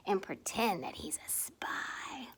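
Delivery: whispered like a secret spy; sneaky whisper. Acted. Telling children the crab pretends to be a spy, whispering. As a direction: hush it conspiratorially.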